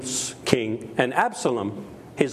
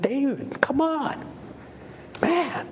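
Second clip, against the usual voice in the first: exasperated soldier; 11 semitones higher, slower than usual. Retold or imitated. Troops protest David's love.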